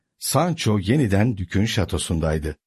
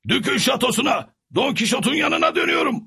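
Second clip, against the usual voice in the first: high servant voice, calling out his choice; resolute Sancho; 14 semitones higher than usual. A narrator's reading. Sancho tells his donkey he's going home, high and earnest.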